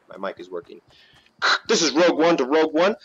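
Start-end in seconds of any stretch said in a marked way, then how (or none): 1.7-3.0 loud, slightly raised radio call. Pretend. Gamer testing the mic to teammates, loud radio call. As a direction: call it out like a squad radio check.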